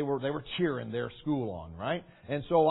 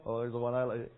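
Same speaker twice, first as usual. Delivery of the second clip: lightly voiced girl; 3 semitones lower, 4 dB quieter than usual. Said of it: "the little girls".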